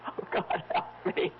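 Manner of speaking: breathless whisper, slow at the end; a shaken whispered plea; frightened whisper. Acted. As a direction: whisper it in dread. Frightened wife recalls faceless man.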